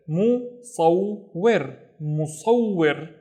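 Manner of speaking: musawwir with the ww held long; doubled w. Shown as a demonstration. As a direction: stretch the ww in musawwir slowly. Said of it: "don't forget to pronounce the wa".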